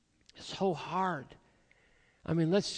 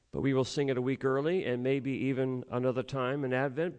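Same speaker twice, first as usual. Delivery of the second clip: ordinary talk, nothing marked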